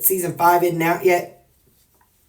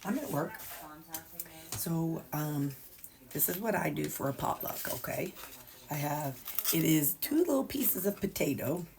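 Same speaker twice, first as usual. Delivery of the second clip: hushed; 14 dB quieter than usual. Real, not acted. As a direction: talk softly so work won't hear. Quiet at work filming.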